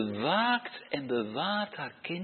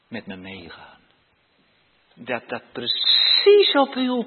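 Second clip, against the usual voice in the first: modelled prayer; 4 semitones lower, 4 dB louder, slower than usual. Shown as a demonstration. (zacht, nederig gebed)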